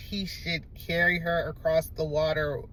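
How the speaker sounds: ordinary talk, nothing marked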